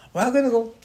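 high, loud mock protest; protesting refusal. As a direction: refuse loudly, imitating a crowd in tears. Acting out the crying people refusing.

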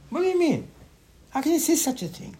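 high, incredulous protest; incredulous mock outrage. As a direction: throw up your hands at the idea. Rabbi mocking the misreading.

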